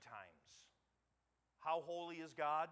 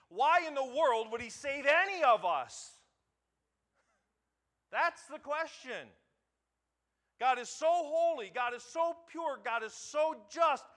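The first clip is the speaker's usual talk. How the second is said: much higher and louder, pounding each phrase; full-force preaching; 9 semitones higher, 13 dB louder than usual. Acted. Pastor thundering to the congregation about God's holiness, full-force preaching. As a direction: build the sermon to a ringing climax.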